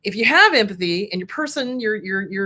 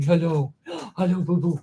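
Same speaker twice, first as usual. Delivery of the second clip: pet greeting. Real, not acted. Greeting her pet Moana.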